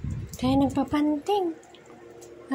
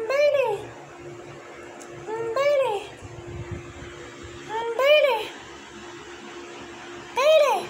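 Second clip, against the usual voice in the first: rising call for Bailey; 5 semitones higher than usual. Real, not acted. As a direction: call the dog from across the yard. Calling the dog Bailey.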